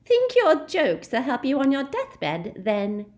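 loud, high mocking jeer; shrill jeering sister, loud. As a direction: sneer at the old man. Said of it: "she jeered".

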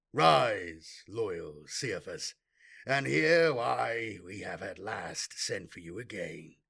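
low, slow, icy command; cold disdainful warlord. Acted. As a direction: command him coldly from the throne. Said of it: "in a disdainful voice".

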